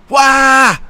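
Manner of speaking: high, loud cartoon wail; a silly burst of fury; playful cry of rage; mock outraged yell. Mock outburst after rant.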